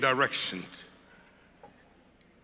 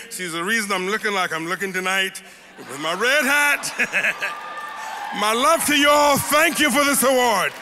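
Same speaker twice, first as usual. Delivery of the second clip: building from an emotional push to a full, much higher and louder shout; rising joyful shout; 6 semitones higher, 4 dB louder than usual. Real, not acted. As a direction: let the gratitude swell until you're shouting it out. Thanking wife, building to cheers.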